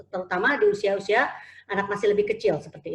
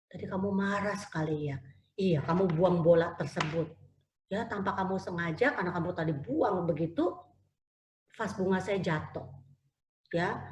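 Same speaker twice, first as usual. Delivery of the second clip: gentle teacher; 7 dB quieter than usual. Retold or imitated. Teacher calmly talks to tantrum child.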